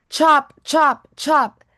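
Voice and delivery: storyteller acting out axe strikes; loud chopping sounds. A narrator's reading. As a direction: hit each whack like an axe blow. Reading chopping sound words.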